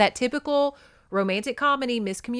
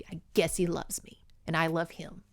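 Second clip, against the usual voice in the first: grudging whisper; 3 semitones lower, 6 dB quieter than usual. Retold or imitated. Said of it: "Julia's like".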